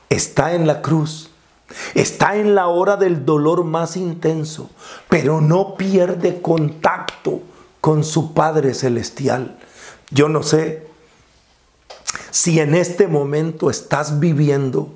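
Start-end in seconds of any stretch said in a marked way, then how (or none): none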